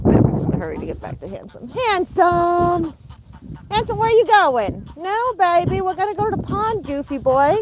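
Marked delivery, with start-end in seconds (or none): 1.7-7.6 high sing-song call; calling pets. Real, not acted. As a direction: coax them in a high voice.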